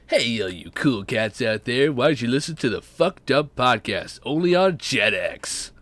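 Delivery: slow, punchy promo read; mock announcer. Plugging the podcast to cool cats, mock announcer voice. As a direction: sell it like a TV channel announcer.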